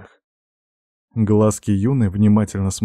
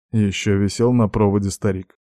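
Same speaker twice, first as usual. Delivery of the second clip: plain reading voice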